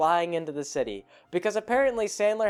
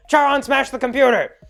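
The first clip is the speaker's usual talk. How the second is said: loud, high gleeful yell; character yelling an order; shouted command; 9 semitones higher, 10 dB louder than usual. Retold or imitated. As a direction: bellow the order to smash it. Voicing character ordering destruction.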